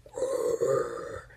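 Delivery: low, drawn-out "grrr"; muffled growl. A narrator's reading. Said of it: "he heard a muffled".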